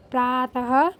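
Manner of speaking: usual speaking voice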